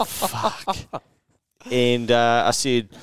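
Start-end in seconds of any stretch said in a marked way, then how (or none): 0.1-0.8 breathy laughing whisper of disbelief; a stunned, half-laughed whisper; whispered fuck. Retold or imitated. Business partner reacts to the impulse buy, whispering in disbelief. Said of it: "standing there being like".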